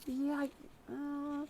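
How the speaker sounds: high, soft, stalling noises of a man caught off guard; awkward hesitant reply. Retold or imitated. Telling his son the radio offer, caught unsure and hesitant. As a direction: let it hang, lost for words. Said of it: "I was like".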